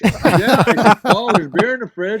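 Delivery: high, loud and eager; excited eager reply. Retold or imitated. Player offering Paul Newman beer, high excited eager reply. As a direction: blurt it out, thrilled to help a star.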